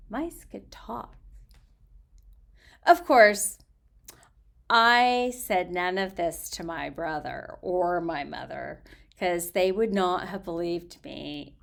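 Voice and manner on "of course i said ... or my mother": ordinary delivery, nothing marked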